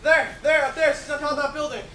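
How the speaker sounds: calling out. Acted. Policeman spots her on roof.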